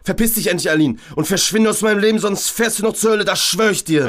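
high, fast raging yell; furious threat. Acted. Georg screams a threat at Aline, high and fast. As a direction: threaten her in a rush of rage.

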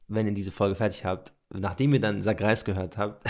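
ordinary talk, nothing marked

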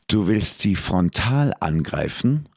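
plain reading voice

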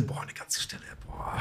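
barely voiced whisper, as if thinking; hushed, whispered wow; awed inner thought. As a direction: whisper it to yourself in amazement. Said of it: "Und dann denkst du".

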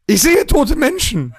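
put-on eerie child line. Host performs Sixth Sense quote.

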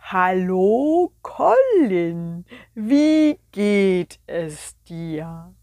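very slow, drawn-out sloth. Acted. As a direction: stretch every word like a sleepy sloth. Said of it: "fing an zu sprechen. Langsam". (langsame Faultierstimme)

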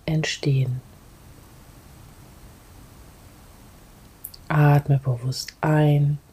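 calm guide, sometimes breathy whisper; guided meditation. Acted. Leads a grounding meditation.

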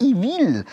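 "Ich will" pitched high, Eastern Swiss; squeaky high "Ich will". Shown as a demonstration. Eastern Swiss man asking for something, high "Ich will". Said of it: "wenn ein Ostschweizer kommt und sagt".

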